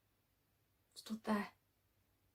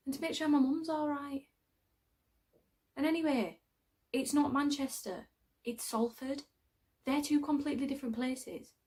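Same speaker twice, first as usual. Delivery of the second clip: defensive; 6 semitones higher, 4 dB louder than usual. Acted. Correcting friend about her hometown.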